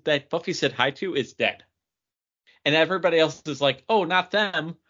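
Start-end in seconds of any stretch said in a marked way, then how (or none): none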